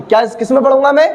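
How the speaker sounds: usual speaking voice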